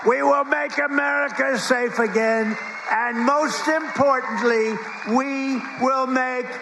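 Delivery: booming rally climax, rising to shouts; rally speech. Acted. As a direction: build the crowd to a roaring finish. Rally closing crescendo.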